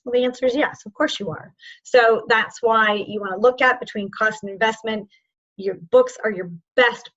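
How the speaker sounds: usual speaking voice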